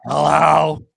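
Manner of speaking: Simpsons twin sisters. Retold or imitated. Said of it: "weird twin sisters from The Simpsons".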